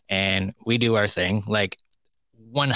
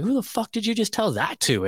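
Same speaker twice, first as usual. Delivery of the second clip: much higher, indignant question; outraged disbelief; 13 semitones higher than usual. Retold or imitated. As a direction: demand it in high-pitched outrage.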